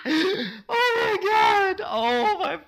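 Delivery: high, slow oh my god through loud laughter; helpless real laughter; gasping with laughter; laughing hard. Real, not acted. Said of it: "I'm laughing my ass off".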